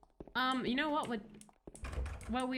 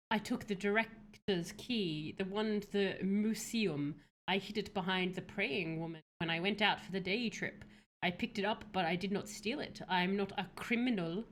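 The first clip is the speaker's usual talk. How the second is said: note read aloud; 5 semitones lower, 4 dB quieter than usual. A narrator's reading. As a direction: read the note out low and measured. Reads a found note aloud.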